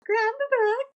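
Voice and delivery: high-pitched sustained singsong; playful singsong. Pretend. Host prompting guest to grab the book, playful high singsong. Playful echo of own request.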